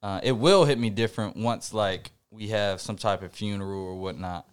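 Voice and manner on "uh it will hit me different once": ordinary delivery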